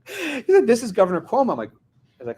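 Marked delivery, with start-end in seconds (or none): 0.7-1.5 high-pitched, self-announcing official; mock-important caller introduction; imitated governor. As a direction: announce yourself grandly, pitched well up.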